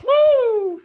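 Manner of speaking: loud high whoop of welcome; excited whoop. Real, not acted. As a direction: cheer the guest in at full voice. Hyping a guest joining.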